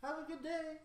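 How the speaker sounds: high cheery shopkeeper farewell; shopkeeper goodbye. Acted. Shopkeeper seeing the customer out, high and cheery.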